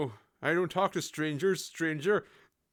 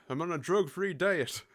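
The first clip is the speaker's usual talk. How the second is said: ordinary delivery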